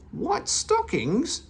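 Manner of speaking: very high, slow amazed question; amazement. A narrator's reading. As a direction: stretch it out in disbelief. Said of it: "said Meddle in amazement".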